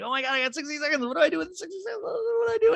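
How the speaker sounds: high, rising squeal of fake panic; squeaky pretend panic over the break; mock panic. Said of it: "And I'm always like".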